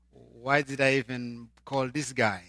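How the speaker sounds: normal talking voice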